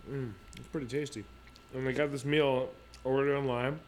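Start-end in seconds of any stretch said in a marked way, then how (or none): 0.1-1.2 chewing while talking. Real, not acted. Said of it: "I'm munching really hard over here".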